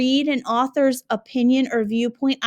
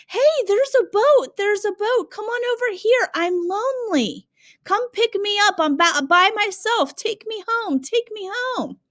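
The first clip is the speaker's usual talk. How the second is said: much higher, pleading castaway; lonely castaway voice; 8 semitones higher than usual. Acted. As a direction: plead to be rescued, hammed up. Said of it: "he's saying".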